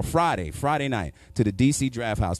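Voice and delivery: normal talking voice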